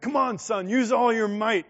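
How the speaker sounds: loud, high, pushing father; a father calling out to strain harder; urging shout. Retold or imitated. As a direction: urge the son on hard.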